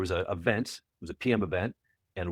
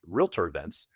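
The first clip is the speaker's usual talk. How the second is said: ordinary delivery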